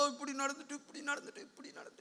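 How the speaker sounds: hushed imitation of complainers, fading to a whisper; mock tearful complaining. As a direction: mimic someone moaning their troubles under their breath. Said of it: "குறைய சொல்லி அழுவோ". (புலம்புவதை மெதுவான குரலில் நடித்து)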